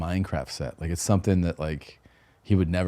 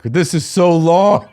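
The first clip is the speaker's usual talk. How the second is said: loud, high, drawn-out whine; mock meltdown; 10 semitones higher, 9 dB louder, slower than usual. Son to dad on the long flight, melting down. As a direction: imitate a child melting down, stretched slow. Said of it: "melting down on this flight".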